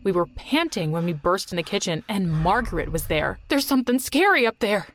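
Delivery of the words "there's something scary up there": mild shriek, pitched up; high, scared outcry at moderate volume; frightened shriek. A narrator's reading. Jerry bursting into the kitchen, shrieking in fright. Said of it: "Jerry shrieked".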